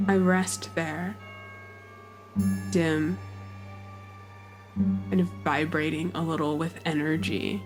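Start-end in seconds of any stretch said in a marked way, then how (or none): none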